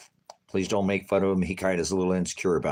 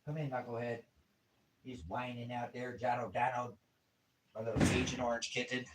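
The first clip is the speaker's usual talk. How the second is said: soft cat talk; 3 semitones higher, 12 dB quieter than usual. Real, not acted. Calling to cat softly.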